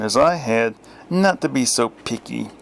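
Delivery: plain reading voice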